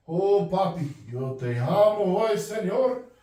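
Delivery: over-sweet holy voice, hammed up; mock pious voice. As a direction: parody a churchy sing-song pleading with God. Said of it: "no cambio mi voz para hablar con".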